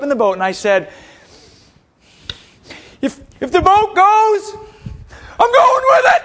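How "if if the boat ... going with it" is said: high, loud, hammed-up heroic cry; mock-heroic yell, stretched out; defiant shout. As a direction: declare it like a doomed captain. Defiant declaration standing in burning boat.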